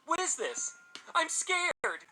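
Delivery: high, loud, frightened wail; shrill-pitched panic; panicked crying out. A narrator's reading. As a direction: cry it out as a terrified boy in tears. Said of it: "he cried".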